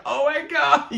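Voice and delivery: high, squealing mock delight; a hammed-up high-pitched gasp; mock gushing. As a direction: gush like an overexcited fiancée. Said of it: "It's like".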